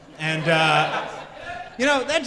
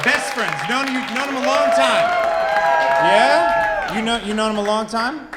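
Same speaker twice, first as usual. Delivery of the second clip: calling out to the crowd; 5 semitones higher, 5 dB louder than usual. Real, not acted.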